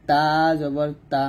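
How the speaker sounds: তা drawn out, tongue at the upper teeth roots; letter articulation demo. Shown as a demonstration. Teacher showing students tongue placement, তা slow and dental. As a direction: tap a slow long তা, then a short one. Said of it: "যেমন এটা হবে".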